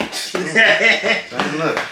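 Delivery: drawn-out loud shout. Real, not acted. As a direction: yell it slowly at the screen. Yelling at the game.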